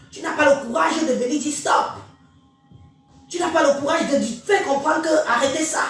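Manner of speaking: normal talking voice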